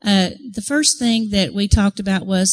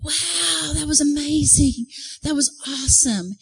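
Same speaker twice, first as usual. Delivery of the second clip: higher-pitched awed onlookers; imitated amazement, raised pitch; amazed crowd; 6 semitones higher than usual. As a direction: marvel like an impressed crowd.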